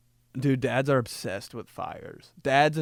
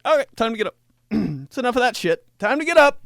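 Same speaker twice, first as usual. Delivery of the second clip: high, loud mock mom yelling up the house; mom's wake-up call; 10 semitones higher, 9 dB louder, much faster than usual. Mom calling her son awake, high and loud. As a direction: shout them awake like a fed-up mom. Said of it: "She'd just be like".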